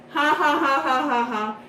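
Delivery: high, staccato mock laughter; fake laugh. Riddle joking with friends at the party, fake laugh. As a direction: put on a forced string of ha-has.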